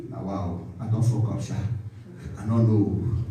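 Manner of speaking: low, subdued realisation; muted, chastened acting; humbled admission. Acting pastor receiving correction humbly.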